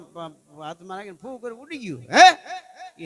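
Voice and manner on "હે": high, loud startled "હે?" with a laugh; shocked cry. Acted. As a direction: gasp it out in sudden shock. Queen's shocked reaction in story. (ચોંકીને ઊંચા અવાજે "હે?")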